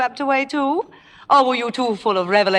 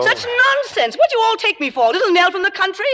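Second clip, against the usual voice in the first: indignant outburst; 9 semitones higher than usual. Acted. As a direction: flare up in outraged disbelief. Margo flares up at everyone.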